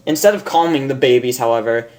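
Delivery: ordinary delivery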